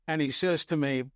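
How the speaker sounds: usual speaking voice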